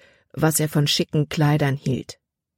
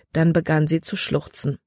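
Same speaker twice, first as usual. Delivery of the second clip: plain reading voice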